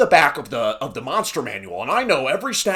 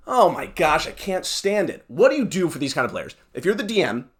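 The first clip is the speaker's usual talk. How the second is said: ordinary delivery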